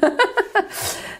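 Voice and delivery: high laugh that breaks off; embarrassed laugh. Real, not acted. Reader laughing at her own muddled words. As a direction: laugh off fumbling your words.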